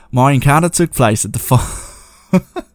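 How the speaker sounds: slow, light Australian accent, lifting at the end. Shown as a demonstration. Starting the reader's story in a light Australian accent. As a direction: read like a laid-back Aussie, a hint only. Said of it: "I'm not gonna do an accent".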